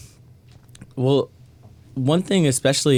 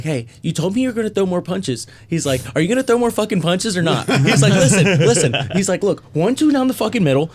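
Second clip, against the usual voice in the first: high, loud hyped corner coach; coach buddy yelling; 8 semitones higher, 4 dB louder than usual. Retold or imitated. Buddy Nick coaching him mid-fight, high and loud. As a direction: bark instructions like a cornerman.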